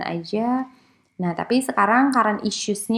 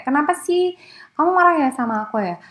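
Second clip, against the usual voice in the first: higher, pleading run of worried questions; anxious girlfriend; 6 semitones higher than usual. Retold or imitated. Lecturer imitates a woman questioning her silent boyfriend, anxious and pleading. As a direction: whine like a partner afraid she did wrong.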